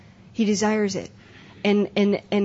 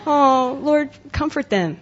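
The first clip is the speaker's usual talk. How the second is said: mock sweet prayer; 5 semitones higher, 4 dB louder, slower than usual. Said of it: "It wasn't like a box of Kleenex".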